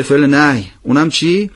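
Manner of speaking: normal talking voice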